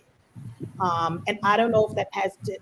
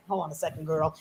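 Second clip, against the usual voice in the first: put-on quiet, lower aside "Hold on a second, girl"; softer aside to the phone; 5 semitones lower, 7 dB quieter, faster than usual. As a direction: drop your voice to put mom on hold. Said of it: "and you're like".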